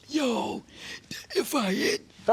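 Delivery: high, laughing excited voice; imitating the label boss. Label boss calling about the record, high excited imitation. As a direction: gush over the record. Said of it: "I like to imitate people".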